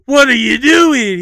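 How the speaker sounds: very high, loud, slow wailing plea; anguished skit cry. Retold or imitated. Skit guy catching the sun hammering his A C, wailing high. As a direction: cry out in mock despair at the sun. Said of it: "And he goes like".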